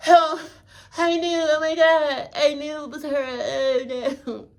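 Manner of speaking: high, fake-crying overacted shock; mock soap-opera dismay, high and teary; mock bad acting. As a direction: overact the grief in a high sobbing voice. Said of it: "The last time you was like".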